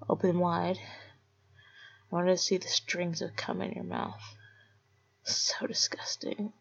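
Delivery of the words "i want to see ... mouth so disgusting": mocking whisper. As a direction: whisper your scorn.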